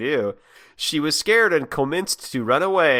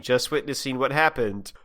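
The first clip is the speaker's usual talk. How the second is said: normal talking voice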